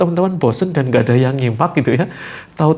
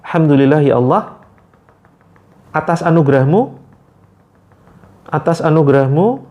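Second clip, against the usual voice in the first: prayer demo. Shown as a demonstration. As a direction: draw out each phrase. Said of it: "Ingat ucapannya". (doa yang khusyuk)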